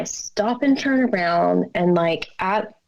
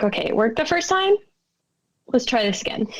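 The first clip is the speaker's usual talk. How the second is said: higher and very fast, rushing it out; hurried self-pep talk; 3 semitones higher, much faster than usual. Retold or imitated. Scared child urging herself to try the prayer again, hurried. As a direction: gather courage in a quick rush. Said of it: "And I was like".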